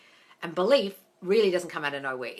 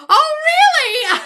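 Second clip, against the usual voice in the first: laughing; 14 dB louder, much slower than usual. Real, not acted. Amused reaction to singing dog.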